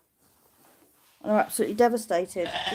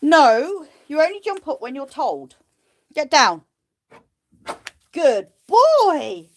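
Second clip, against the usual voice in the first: high, louder; command and praise; 6 semitones higher, 7 dB louder, slower than usual. Real, not acted. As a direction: train him. Training the sheep.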